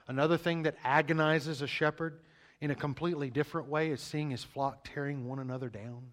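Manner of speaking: ordinary delivery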